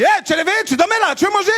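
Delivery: high, loud African stewardess; chirpy hostess wakes him; mock stewardess. Stewardess wakes the comedian, loud high voice.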